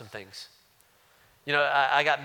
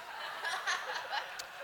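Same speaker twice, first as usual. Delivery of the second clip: short unvoiced laugh; soft laugh; 10 dB quieter than usual. Real, not acted. Pastor laughing at finding the cookie wrapper, soft laugh. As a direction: break into a quiet laugh.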